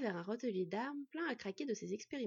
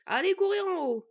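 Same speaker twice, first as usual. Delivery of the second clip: loud, high command; shouted order; 9 semitones higher, 12 dB louder than usual. A narrator's reading. Smith sending the twins upstairs, commanding shout. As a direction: order children out, much louder. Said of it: "leur ordonna le forgeron des étoiles".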